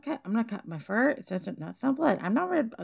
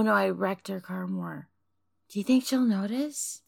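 ordinary talk

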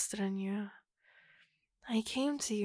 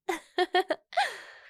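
loud, high gleeful laugh; a sudden bright cackle of glee; delighted high laugh; 8 semitones higher, 6 dB louder than usual. Acted.

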